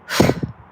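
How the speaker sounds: imitated last breath of a cat; hard nose exhale. Imitating the dying cat, a hard nose exhale. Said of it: "kinda breathed real hard out of its nose".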